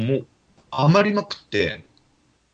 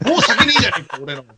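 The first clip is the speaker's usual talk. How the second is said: higher, louder mock outburst through a laugh; mock angry senior; 8 semitones higher, 5 dB louder than usual. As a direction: play the drunk senior griping about his sake. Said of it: "もうなんだ、 あいつら". (先輩の酔った文句を笑いながら高く大声でまねる)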